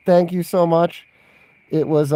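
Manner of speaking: normal talking voice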